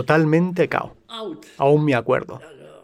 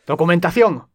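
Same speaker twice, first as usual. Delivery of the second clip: barked, louder and higher order for papers; stern soldier demand; 4 semitones higher, 5 dB louder than usual. Retold or imitated. Patrol soldier stopping him demands his papers in a stern bark.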